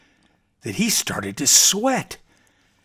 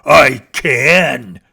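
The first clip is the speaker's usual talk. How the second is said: growled; 9 dB louder than usual. Acted. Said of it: "growled the bear".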